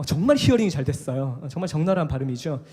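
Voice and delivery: ordinary talk, nothing marked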